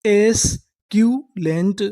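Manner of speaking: एस्क्यूलेंट drawn out slowly, rising at the end; slow sounding out. Shown as a demonstration. Teacher sounding out the word for students, slow. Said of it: "पूरी meaning क्या बनी?".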